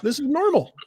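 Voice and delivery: high, unbothered dog voice; voicing the dog. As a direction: squeak it out like a chill little dog.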